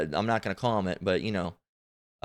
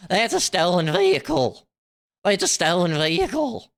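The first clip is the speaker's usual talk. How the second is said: mocking imitation; 7 semitones higher, 3 dB louder than usual. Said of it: "And she said".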